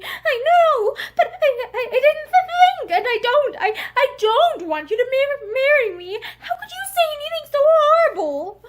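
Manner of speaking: distraught sobbing. A narrator's reading. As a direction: cry the words out heartbrokenly. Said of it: "Charity sobbed heartbrokenly".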